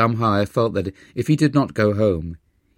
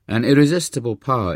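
ordinary delivery

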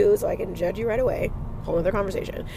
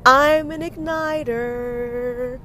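very slow, higher, stretched to the end; drawn-out declaration; 3 semitones higher, much slower than usual. Acted. Host declaring her title to her inner critic, slow proud proclamation. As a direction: proclaim it, dragging out every word.

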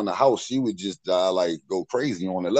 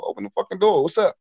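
higher-pitched, mildly impatient retold demand; 9 semitones higher, faster than usual. Visitor calling to people hiding behind the door, mildly impatient demand. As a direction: reenact demanding to be let in.

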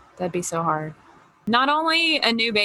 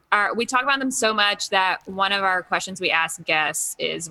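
normal talking voice

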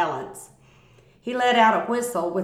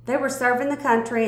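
ordinary delivery, nothing marked

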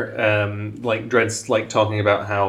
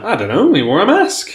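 put-on higher, louder doddering old-timer voice; confused old man; 10 semitones higher, 5 dB louder than usual. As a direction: play a forgetful old prospector. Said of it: "And the guy's like".

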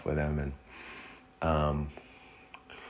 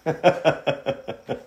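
a high, breathy burst of laughter; 11 semitones higher, 4 dB louder than usual. Real, not acted. Laughs at the absurd memory of the elevator. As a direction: laugh out loud at the punchline. Laughing at his own story.

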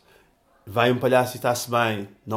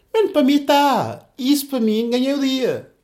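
genuinely delighted; 10 semitones higher, 4 dB louder than usual. Retold or imitated. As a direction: relive the joy of that moment. Delight at a friend's insult. (encantado, muito mais agudo e lento)

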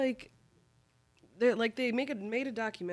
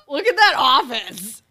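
shouted, slow and much higher; loud exclamation; 9 semitones higher, 16 dB louder, slower than usual. Real, not acted. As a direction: call it out in disbelief. Showing the messy office.

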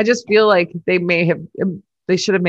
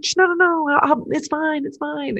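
imitating Horner hushing; 9 semitones higher than usual. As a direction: shush and soothe an angry driver. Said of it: "And he's like".

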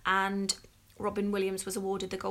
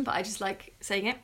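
normal talking voice

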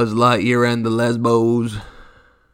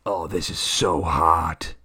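lower, slow lecherous voice; mock Disney exec; 5 semitones lower, slower than usual. Disney execs imagined leering, slow low voice. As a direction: purr it lewdly. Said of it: "what Disney was thinking".

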